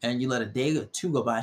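ordinary delivery

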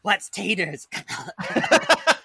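high, slow, whispered rasp; Gollum voice. Retold or imitated. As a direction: drag it out like Gollum. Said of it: "It's Gollum".

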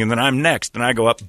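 ordinary talk

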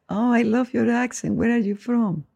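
high, drawn-out imitation of an admirer; imitando a admiradores curiosos. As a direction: gush slowly in a high mock-curious voice. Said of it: "todo el mundo ... me pregunta". (imitación aguda y alargada de un admirador)

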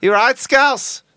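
high, slow hammed-up Scouse; Scouse accent. Comedian imitating Liverpool locals, exaggerated Scouse accent. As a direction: drawl a high mock Liverpool greeting.

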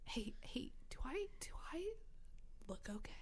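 imitating a furtive, insecure whisper; anxious whisper. Imitating friend sidling up at a party, anxious whisper. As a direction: whisper it hesitantly, seeking reassurance.

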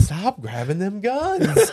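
high, slow, laughing scold. Pretend. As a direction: scold your hand while laughing. Scolding own hand, laughing.

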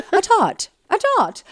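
high-pitched mock British "tart", no R; British accent. Shown as a demonstration. Podcaster playing with the nickname, high hammed-up British accent. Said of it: "How do they say the R's in British?".

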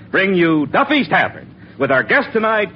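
ordinary delivery

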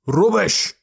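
high, loud scornful outburst; snarling. A narrator's reading. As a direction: snarl it out sharply. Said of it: "snarled Gordon".

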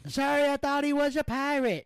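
high, loud cartoon gator voice; Gator character voice.

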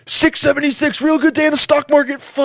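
high mock climax scream; hammed-up orgasmic yell; screamed net worth. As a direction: scream it out, ending on a long held cry. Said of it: "just scream my net worth".

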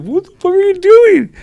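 high, loud outraged yell; shocked shout. Retold or imitated. Spanked stranger yelling at the teller, high loud outrage. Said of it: "And he's like".

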